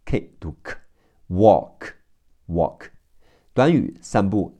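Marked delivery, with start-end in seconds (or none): none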